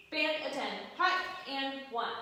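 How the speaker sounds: loud, high barked drill command; parade commander. Shown as a demonstration. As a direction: bark it across the parade ground. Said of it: "somebody gives you".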